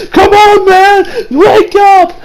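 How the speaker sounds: imitating a voice actor's high, loud cry; desperate shout. Imitating Josuke trying to wake Okuyasu, desperate shout. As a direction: shout it high, drawing out the plea. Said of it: "the voice actor here is great".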